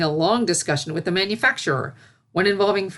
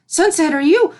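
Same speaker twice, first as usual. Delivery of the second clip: higher, louder worried call; alarmed call; 11 semitones higher, 8 dB louder than usual. A narrator's reading. Celestia alarmed pulling filly out.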